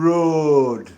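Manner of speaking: loud, drawn-out Sheffield "road"; exaggerated Sheffield "road"; Sheffield accent. Shown as a demonstration. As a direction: say "road" in broad Sheffield, long vowel. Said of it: "You would say it as".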